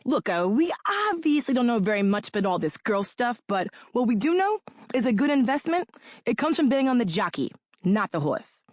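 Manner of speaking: put-on a rough, half-hearted Long Island accent; bad Long Island accent. Imitating the Long Island lead investor's offer, weak Long Island accent. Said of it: "I can't really do a Long Island accent, but it was something like this".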